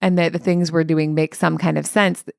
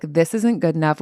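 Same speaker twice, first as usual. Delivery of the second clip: self-judging. Shown as a demonstration. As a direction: say it as a verdict against yourself. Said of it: "which means I'm not good enough".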